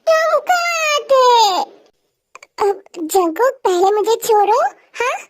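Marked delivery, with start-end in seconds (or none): 0.1-1.3 higher, crying question; tearful child. Acted. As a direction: cry where were you. (रोते हुए पूछना)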